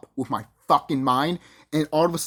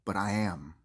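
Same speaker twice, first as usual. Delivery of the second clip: low, soft, unbothered reply; deadpan comeback; 9 semitones lower, 9 dB quieter than usual. Retold or imitated. Intruder answering the panicked staff, deadpan comeback. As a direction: drop it low and quiet, unfazed.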